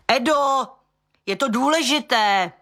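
high yell toward the house, pitched well up; desperate high call through the door; yelling for Edo. A narrator's reading. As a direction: call out to him, straining to be heard. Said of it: "Kdo to tu tak ječí".

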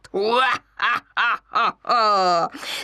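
wild hyena cackling laugh; hyena cackle. Acted. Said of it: "jak se příšerně chechtat".

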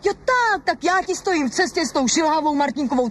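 high, indignant repetition; can't believe what she hears; outraged disbelief. Acted. Wife throws the accusation back, outraged.

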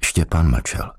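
plain reading voice

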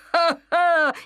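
loud high cackling laugh; high cackle. A narrator's reading. Hyena laughing with the lion, high cackle. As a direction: cackle at full tilt.

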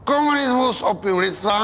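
high-pitched, proclaimed slogan; defiant declaiming. Acted. Man proves he dares say it, defiant proclamation.

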